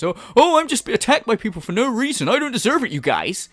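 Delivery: lifted, whining parody of self-pity; mock victim. Commentator imitates him pleading to the lawyer, mock victim. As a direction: play the wronged innocent, hammed up. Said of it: "what a victim he is".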